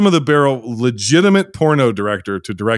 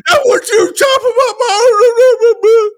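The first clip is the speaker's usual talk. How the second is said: very high, loud mocking bawl; hammed-up tantrum, crying out for Mama; wailing Junior; 5 dB louder than usual. Podcaster mimicking a movie oaf crying to his mama, hammed-up high wail. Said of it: "where Junior is on the fucking dirt bike going".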